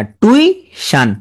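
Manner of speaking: টিউশন said as "tui ... shan", high first part; spelling pronunciation. Shown as a demonstration. Teacher helping students keep the i, slow split টিউশন. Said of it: "তার আর ভুল হবে না".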